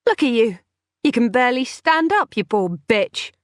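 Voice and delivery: mock her weakness; scornful. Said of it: "scornful defiance".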